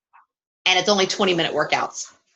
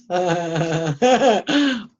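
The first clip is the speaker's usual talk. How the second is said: real giggling fit that takes over; laughing; slower than usual. Real, not acted. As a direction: crack up at your own joke. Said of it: "I'm laughing".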